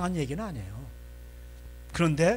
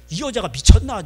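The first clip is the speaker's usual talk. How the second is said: loud, exasperated outburst; losing his temper; 2 semitones higher, 10 dB louder than usual. Acted. Jacob answering Rachel's demand, loud and angry. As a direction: snap back at your wife. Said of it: "야곱도 성질 내는 거야".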